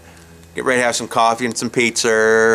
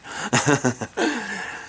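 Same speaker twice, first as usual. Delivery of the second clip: amused chuckle; a chuckle at his own line; 6 semitones higher, 6 dB quieter than usual. Real, not acted. Storyteller tickled by his shiny RV detail, chuckling.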